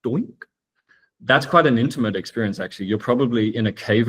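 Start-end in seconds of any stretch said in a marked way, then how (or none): 0.0-0.3 a single drop plinking softly; imitated raindrop. Shown as a demonstration. Imagining one sole raindrop in a cave, soft doink. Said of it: "if you heard one soul raindrop".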